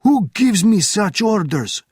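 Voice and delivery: high, loud challenge; shouted. A narrator's reading. Officer shouting at Benjamin, high and loud. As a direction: shout it back defiantly. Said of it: "shouted the officer".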